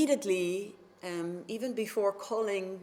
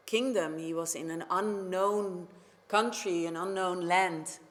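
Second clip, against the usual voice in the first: ordinary delivery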